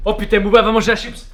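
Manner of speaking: loud alarmed cry. Real, not acted. Dog about to eat chip.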